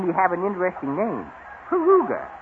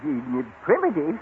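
ordinary talk

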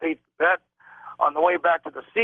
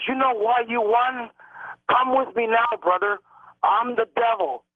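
higher and slow, a lightly menacing call; mock yell; 7 semitones higher than usual. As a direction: play the stranger calling him off, slowly. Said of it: "he's going to me in a loud voice".

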